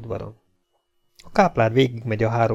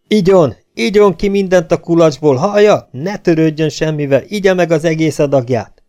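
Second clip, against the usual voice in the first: high, loud, urgent shouting; desperate yelling; 6 semitones higher, 6 dB louder than usual. A narrator's reading. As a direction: plead at the top of your voice to rouse someone. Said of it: "kétségbe esetten ordított a fülébe".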